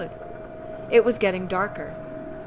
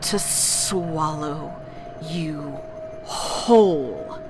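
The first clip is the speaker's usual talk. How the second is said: lower, very slow monster voice; slow creature voice; 4 semitones lower, much slower than usual. Acted. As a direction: draw it out low and hungry. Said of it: "the creature answers".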